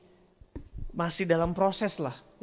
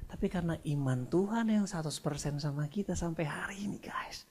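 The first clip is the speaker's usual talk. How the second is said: whisper; 3 semitones lower, 9 dB quieter than usual. Acted. Climax of sermon point.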